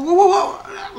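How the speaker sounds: mock outrage; loud protest. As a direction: stop him loudly, half laughing. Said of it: "And I go".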